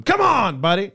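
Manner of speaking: high, loud mock coaxing. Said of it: "when they ask you to go".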